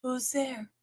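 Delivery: breathy hushed question, teasing; playful whisper. Pretend. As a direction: whisper it like a spooky secret. Playing knock-knock with herself.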